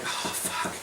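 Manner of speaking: voiceless, sighed-out curse; dismayed whisper. Retold or imitated. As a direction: let it escape under your breath. Retells his sinking bad feeling.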